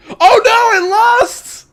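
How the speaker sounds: real high shocked squeal; shocked shriek. Real, not acted. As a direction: shriek at the loss. Player reacts to failed roll.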